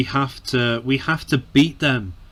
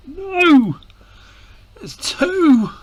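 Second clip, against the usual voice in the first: much higher, louder cry of disbelief; dismayed shout; 5 dB louder, much slower than usual. Real, not acted. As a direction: yell it at the screen in horror. Opponent scores again.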